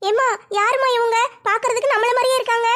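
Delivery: put-on young daughter voice.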